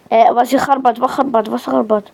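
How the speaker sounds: ordinary delivery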